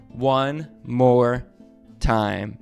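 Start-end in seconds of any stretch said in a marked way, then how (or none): none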